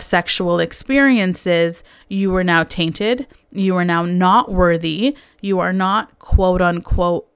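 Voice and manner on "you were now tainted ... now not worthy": usual speaking voice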